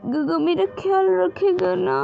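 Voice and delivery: put-on high, tearful little girl's sobbing plea; crying child. A narrator's reading. Little Wing weeps to Gaga about her parents, high and tearful. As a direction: cry it out like a lost child. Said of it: "फिर से रोने लग जाती है".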